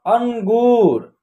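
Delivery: अंगूर drawn out very slowly, an-goor; slow blended word. Shown as a demonstration. Teacher slowly joins अंगूर for beginners. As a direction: stretch अंगूर gently, sound by sound. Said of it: "अन".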